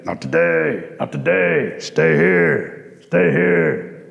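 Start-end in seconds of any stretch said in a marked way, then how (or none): 0.0-1.0 "not today" dropped very low like a frog croak; bullfrog voice. Acted. Bullfrog refuses Rabbit entry. 1.8-4.1 very low croaked "stay here"; bullfrog voice. Acted. Bullfrog orders Rabbit to wait.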